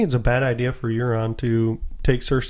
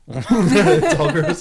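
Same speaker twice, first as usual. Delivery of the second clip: laughing; 3 semitones higher, 4 dB louder, much slower than usual. Real, not acted. Laughing verdict.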